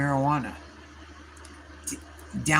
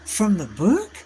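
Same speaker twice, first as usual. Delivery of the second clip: surprised disbelief; 6 semitones higher, 3 dB louder than usual. Retold or imitated. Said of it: "And I was like".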